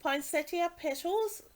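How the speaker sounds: normal talking voice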